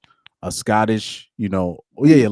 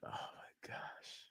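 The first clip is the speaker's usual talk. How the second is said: whisper; 32 dB quieter, much slower than usual. Real, not acted. Reacting to misspelling.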